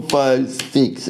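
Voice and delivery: ordinary talk, nothing marked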